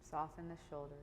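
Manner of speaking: soft, unhurried meditation guidance; guided meditation. Real, not acted. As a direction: ease them into the breath, long pauses between phrases. Leads a breathing meditation.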